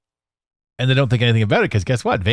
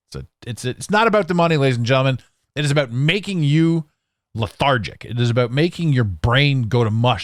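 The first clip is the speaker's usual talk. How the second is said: normal talking voice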